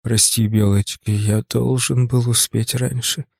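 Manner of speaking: low, soft, slow male character voice; tender apology. A narrator's reading. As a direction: apologise gently, slowing down. Tony tenderly apologises while comforting her.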